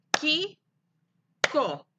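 "Kiko" split into Ki, pause, ko; high rising "Ki", falling "ko" in "Kiko"; clapped syllables. Shown as a demonstration. Teacher invites pupils to clap along, "Kiko" in separate beats. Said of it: "Aplaudan conmigo".

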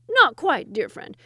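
loud high start dropping to a patronising close; indulgent, smiling. A narrator's reading. Second wazir correcting his dear friend, indulgently. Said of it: "smiled the second wazir indulgently".

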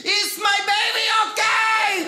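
a mother terrified for her son; panicked mom. Retold or imitated. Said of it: "Here's my mom's voicemail".

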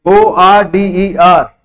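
letters "O R D E R" spaced slowly; spelling out slowly. Shown as a demonstration. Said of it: "तुम्हारा मतलब spelling". (धीरे-धीरे अक्षर बोलना)

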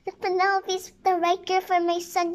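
high-pitched, mildly proclaiming tone; declaration. A narrator's reading. Queen naming her son's bride, high-pitched declaration. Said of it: "she declared".

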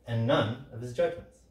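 ordinary talk, nothing marked

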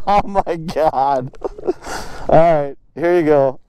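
ordinary delivery

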